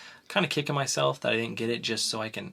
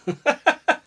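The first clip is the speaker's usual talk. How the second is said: loud, high laugh; high burst of laughter; 7 dB louder than usual. Real, not acted. Host laughing at the girlfriend's wrong guess, high laugh.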